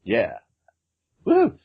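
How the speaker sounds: normal talking voice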